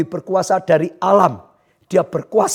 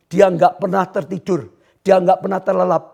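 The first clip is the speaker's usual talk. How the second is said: ordinary talk, nothing marked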